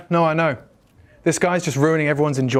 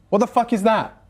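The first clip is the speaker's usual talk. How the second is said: indignant outburst; 7 semitones higher than usual. Acted. Indignant rant to the group.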